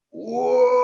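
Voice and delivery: high drawn-out whoop of a heaving boat; imitated lurching whoa. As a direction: mimic the boat's big lurch with a whoop. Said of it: "The boat goes".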